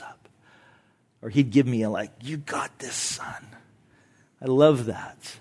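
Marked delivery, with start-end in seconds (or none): 2.2-3.4 whispered dad's encouragement; soft and close, like a nod from the stands; quiet encouragement. Retold or imitated. Father in the stands encouraging his son, whispered.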